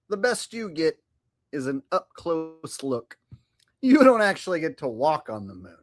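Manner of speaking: ordinary talk, nothing marked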